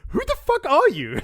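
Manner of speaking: high, loud, baffled shriek; Ty Lee shrieking at the stranger; incredulous Ty Lee. Retold or imitated. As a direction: squeal it in total disbelief. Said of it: "the last thing she says is like".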